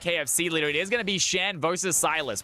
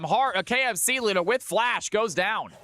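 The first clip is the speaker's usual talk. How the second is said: normal talking voice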